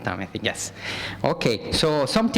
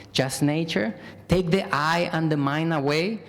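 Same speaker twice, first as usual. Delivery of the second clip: normal talking voice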